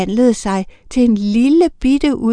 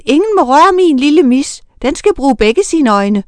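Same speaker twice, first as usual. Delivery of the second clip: higher and louder, pleading in alarm; frightened protest; 5 semitones higher, 6 dB louder than usual. A narrator's reading. Miranda shouting to protect her kitten, frightened. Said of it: "råbte Miranda forskrækket".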